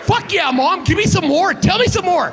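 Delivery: high, hyped-up cheering on; excited cheering. Acted. Cheering mom's card on.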